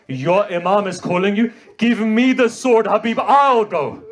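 high, loud grieving preacher's cry; impassioned recitation. Acted. Reciter retelling Hussein's urgent letter, loud impassioned lament. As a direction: lament it to the mourners at full force. Wife demands the sword.

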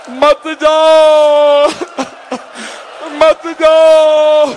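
very long, high wailing cry; wailing plea. Retold or imitated. Women clinging to the departing young man, wailing plea. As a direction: stretch each word into a sustained high lament. Women beg Ali Akbar to stay.

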